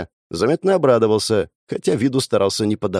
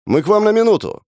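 higher, louder call through the door; 11 semitones higher, 4 dB louder than usual. A narrator's reading. Kostenko calls through the door to the house, loudly. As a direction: call out to be heard inside. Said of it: "громко сказал Костенко".

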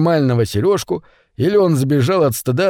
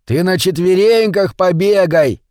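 mild shout, raised pitch; 5 semitones higher than usual. A narrator's reading. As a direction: call out a command, higher. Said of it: "кричал Митя". (негромкий выкрик, повыше)

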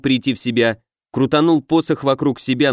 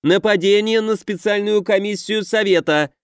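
higher shrill outcry; higher alarmed yell at the attacker; high yelling accusation; 8 semitones higher than usual. A narrator's reading. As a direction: cry it out to the whole square. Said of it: "завопил на всю площадь".

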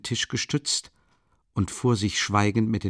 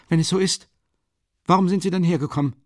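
higher, louder, fast and irritated; exasperated demand, rushing; 9 semitones higher, 5 dB louder, faster than usual. A narrator's reading. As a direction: snap the question back at him. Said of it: "fragte Raskolnikow gereizt".

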